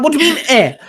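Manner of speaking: high-pitched, incredulous retort; panicked disbelief. Retold or imitated. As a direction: replay your baffled panic at a friend. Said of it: "I'm here panicking".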